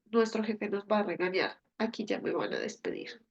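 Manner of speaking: low, soft, understated dread; mild worry. Acted. Worker fearing the boss after a mistake, understated worry. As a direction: voice it low, like a private worried thought. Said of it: "a veces nos da temor".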